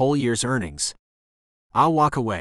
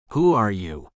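shaky gasp. A narrator's reading. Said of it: "Amanda gasps in a shaky voice".

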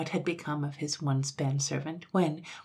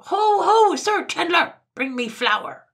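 very high full-force yell throughout; piercing imperious shout; louder cry; 14 semitones higher, 11 dB louder, slower than usual. A narrator's reading. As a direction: bellow the whole summons furiously high. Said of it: "cried again louder than before".

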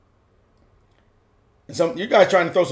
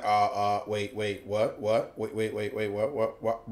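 low, hushed, repetitive stammer; flustered stammer; 8 semitones lower, 13 dB quieter than usual. Retold or imitated. Commentator voicing a player caught off guard, flustered muttering. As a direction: mutter it under your breath, thrown off.

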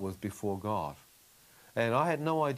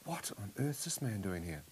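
soft, lower retold inner question; hushed bewildered thought; 3 semitones lower, 8 dB quieter than usual. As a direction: think it aloud quietly, puzzled. Voicing a stunned inner thought.

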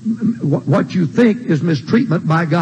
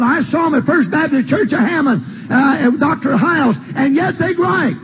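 high-pitched, driving sermon delivery; full-force preaching; 7 semitones higher than usual. Real, not acted. Preacher telling gripers who isn't the problem, high driving preaching.

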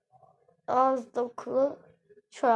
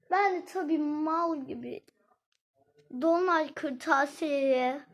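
ordinary talk

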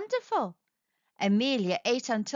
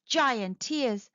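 plain reading voice

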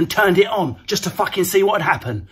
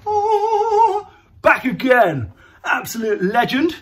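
mock cheer; 6 semitones higher, slower than usual. Mock hailing Boris's return.

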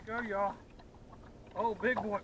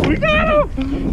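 excited shout; 15 dB louder, much slower than usual. Real, not acted. Fish landed in net.

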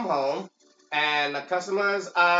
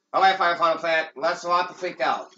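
usual speaking voice

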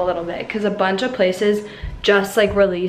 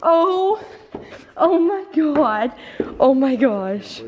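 overwhelmed; 5 semitones higher, slower than usual. Real, not acted. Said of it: "I'm, like, about to cry".

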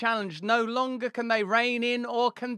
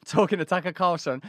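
usual speaking voice

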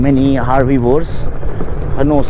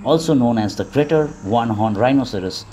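calm, even wildlife narration; nature documentary; 2 semitones lower, 7 dB quieter than usual. Acted.

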